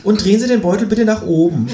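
high voice for the screening officer; lightly voiced officer; screener woman.

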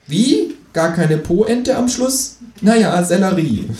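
high, drawn-out kangaroo complaint. Acted. Kangaroo comments in footnote.

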